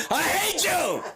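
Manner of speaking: high, drawn-out, laughing shriek of hate; hammed-up squeal of hatred; mock outburst. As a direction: wail it out high through laughter.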